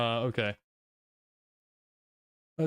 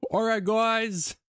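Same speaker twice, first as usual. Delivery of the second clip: much higher, slow, louder put-on intro voice; YouTuber impression; 7 semitones higher, 3 dB louder, slower than usual. Mimics a YouTuber introducing a video, slow and loud. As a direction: stretch out a bright YouTuber greeting. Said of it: "I can't do his accent".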